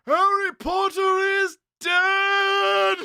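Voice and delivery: villain proclamation. Retold or imitated. Quoting Voldemort's movie line, slow high mock proclamation. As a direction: proclaim it like a gloating dark lord.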